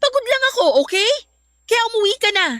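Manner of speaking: irritated outburst. Acted. Girlfriend snapping at Gerson to go home, irritated. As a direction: bite off the denial and send him away. She denies, tells him leave.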